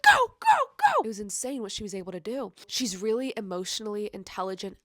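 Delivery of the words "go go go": very high, loud cheering; shrieking go go go. Retold or imitated. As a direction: cheer her on from bed. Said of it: "I was literally sitting in my bed and I was like".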